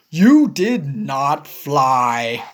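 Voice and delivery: low, slow elephant voice; plainly correcting a friend; Gerald voice. Acted. Gerald telling Piggy she only jumped: low and slow.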